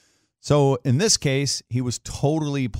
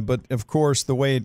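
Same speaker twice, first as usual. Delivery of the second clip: usual speaking voice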